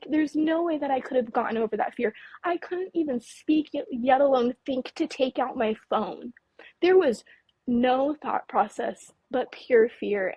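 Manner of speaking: ordinary talk, nothing marked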